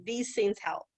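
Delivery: ordinary talk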